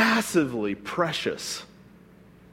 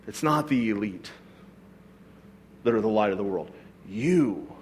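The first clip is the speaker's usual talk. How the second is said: tender quiet Jesus; 8 semitones lower, 4 dB quieter than usual. Retold or imitated. Pastor softly voicing Jesus to people.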